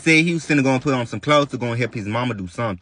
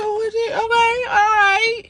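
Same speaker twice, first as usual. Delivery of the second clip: high wailing sob through the words; crying out in shock and hurt; tearful high cry. Retold or imitated. At the park, calling to her man, sobbing high.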